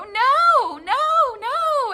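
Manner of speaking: very high, loud happy squeal; gleeful greeting tone. Shown as a demonstration. Owner glad to see her dog come in, high excited squeal. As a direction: coo a no as if it were praise. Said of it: "and say no like that".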